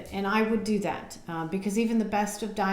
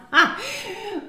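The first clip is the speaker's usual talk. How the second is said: self-conscious laugh; 12 semitones higher, 7 dB louder than usual. Real, not acted. Laughing at herself for blanking on camera, loud embarrassed laugh. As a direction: burst of high, loud laughter.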